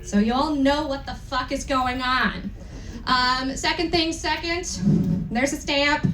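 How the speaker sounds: ordinary delivery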